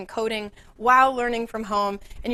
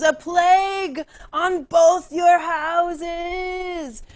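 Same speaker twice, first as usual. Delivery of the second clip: very slow, loud, high stage shout; theatrical curse; 7 semitones higher, 4 dB louder, much slower than usual. Acted. Mercutio cursing both houses as he dies, slow loud shout. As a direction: declaim the curse like a dying actor. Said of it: "this famous line".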